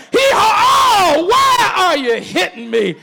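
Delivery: high, loud, laughing donkey voice; the wronged donkey complains, braying; talking donkey. Retold or imitated. Talking donkey protests to Balaam, loud and high. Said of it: "this time he said".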